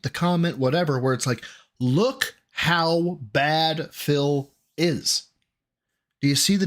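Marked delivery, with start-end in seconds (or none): 1.8-5.2 very slow, word-by-word, pauses between words; slow emphatic quote. Retold or imitated. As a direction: LOOK HOW BAD PHIL IS, each word on its own.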